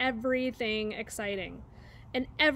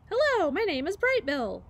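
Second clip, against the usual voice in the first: higher, chirpy greeting; little gosling; 7 semitones higher, 6 dB louder than usual. Acted. Gosling introduces himself to the squirrel, chirpy little voice. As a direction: chirp it bravely to a stranger.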